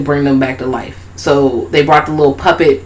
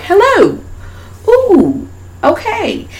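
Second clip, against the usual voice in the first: very high surprised greeting; high chirpy doll voice; much slower than usual. Retold or imitated. Friend retelling the movie, the revived dolls popping up, high chirpy. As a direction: pop up high and bright.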